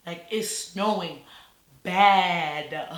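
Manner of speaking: pause, then "bad" loud and drawn out; slow build, landing hard on "bad"; stress on bad. Real, not acted. Telling viewers about the blizzard, "bad" hit hard after a pause. Stressing how heavy the snow was.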